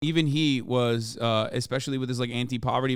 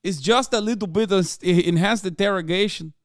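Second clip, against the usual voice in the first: put-on Gru-like accent; 6 semitones higher, 4 dB louder than usual. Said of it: "He sounds like Gru".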